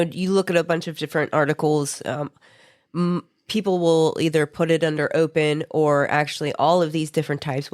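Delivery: ordinary delivery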